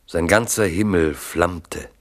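normal talking voice

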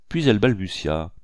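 ordinary delivery, nothing marked

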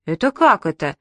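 ordinary delivery, nothing marked

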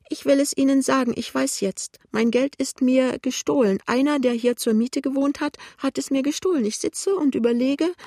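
put-on high, agitated old woman's lament; pitiful. A narrator's reading. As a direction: wail it high, bewildered. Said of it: "sagt die alte Frau kläglich". (klägliche, hohe Altfrauenstimme)

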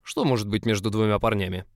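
mocking repeat.

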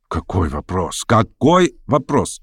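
plain reading voice

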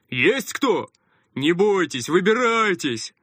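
loud stranger's call down a hatch; shouting. A narrator's reading. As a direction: shout down into the dark. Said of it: "незнакомый голос прокричал".

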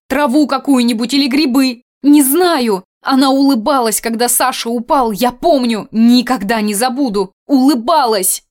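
громкий высокий крик ненависти; выплесни всю ненависть; яростно крича. A narrator's reading. Аня кричит о ненависти. (яростный крик)

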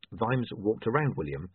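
ordinary delivery

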